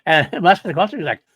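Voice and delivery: ordinary delivery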